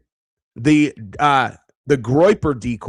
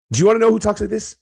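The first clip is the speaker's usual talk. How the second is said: heated rhetorical burst; 7 semitones higher, much faster than usual. Real, not acted. Ranting at the DM.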